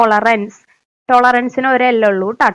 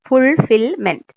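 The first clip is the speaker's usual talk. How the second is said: Fulfilment spoken slowly and clearly, each syllable given its time; slow, careful teacher's fulfilment; slower than usual. Shown as a demonstration. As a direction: take fulfilment slowly so the single L is heard. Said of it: "പതുക്കെ പറഞ്ഞാ മതി".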